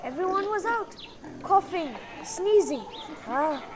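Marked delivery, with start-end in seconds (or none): none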